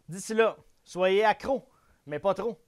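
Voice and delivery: slow, upbeat show sign-off; sign-off catchphrase. Acted. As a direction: deliver the catchphrase like a host. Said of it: "je finis toujours mes capsules avec la même phrase".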